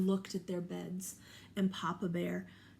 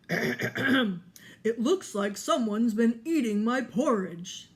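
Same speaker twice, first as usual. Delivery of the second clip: Papa Bear; 2 semitones higher, 8 dB louder, slower than usual. Acted. Papa Bear voiced in story.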